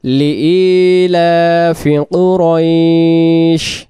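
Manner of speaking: slow drawn-out tajweed recitation; measured Quranic chant. Shown as a demonstration. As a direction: recite each word slowly and carefully. Recitation from the start.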